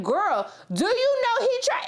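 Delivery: high, slow scandalized gossip; telling her mother what happened, high and slow; mock scandalized. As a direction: spill the tea in disbelief.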